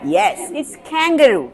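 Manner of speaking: kangaroo with stress on the last syllable, roo drawn long; English kangaroo. Shown as a demonstration. Teacher shows the class the proper kangaroo, stress at the end. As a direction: model kangaroo with a rising, long final roo. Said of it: "കങ്കാരുവിന്റെ pronunciation എങ്ങനെയാ?".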